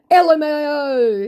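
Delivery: very high, loud, drawn-out; exaggerated slow letter-by-letter mockery; mock scammer. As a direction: spell it out as a tittering scammer. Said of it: "he writes".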